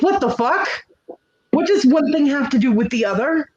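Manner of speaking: high, disbelieving what the fuck; high incredulous retelling; incredulous outburst. Retold or imitated. Retold outburst at her son.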